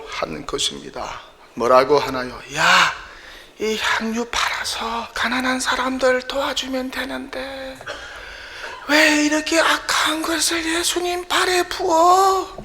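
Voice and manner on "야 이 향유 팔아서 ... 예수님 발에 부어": shrill, high, scolding rant that builds to a shout; 높고 날카롭게 화내며 소리치는 유다 흉내; mocking angry outburst. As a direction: play Judas berating Mary for wasting the perfume, voice climbing. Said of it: "화를 내면서 소리를 치고".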